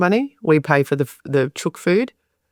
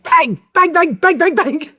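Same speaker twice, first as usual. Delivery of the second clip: high-pitched mock gunfire; imitated duck-scaring shouts; 13 semitones higher, 3 dB louder, slower than usual. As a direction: yell rapid fake gun bangs. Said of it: "he rang out and he went".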